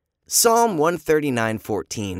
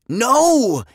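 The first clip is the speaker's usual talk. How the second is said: loud no; 12 semitones higher, 8 dB louder than usual. A narrator's reading. Kid answering loudly.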